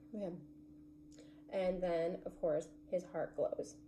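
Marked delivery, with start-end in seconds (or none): 0.0-0.4 breathy unvoiced man; whispered sigh. Real, not acted. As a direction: exhale it, moved. Sigh after emotional scene.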